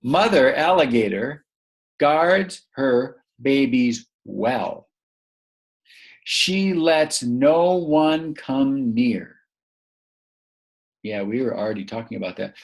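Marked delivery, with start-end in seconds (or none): 0.0-9.4 very slow, careful storybook reading; slow read-aloud. Shown as a demonstration. Teacher reading the sentences with the child, slow. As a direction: spell the sentence out slowly for the learner. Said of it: "Let's read 'em".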